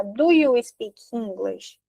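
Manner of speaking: slow, careful "Do you speak English?"; slow model question. Shown as a demonstration. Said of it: "Se eu falo assim".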